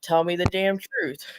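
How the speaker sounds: normal talking voice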